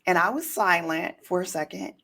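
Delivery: usual speaking voice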